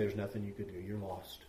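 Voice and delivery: hushed verdict. Pretend. Pastor voicing a grim verdict to a penitent, hushed. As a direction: deliver the bad news quietly and heavily.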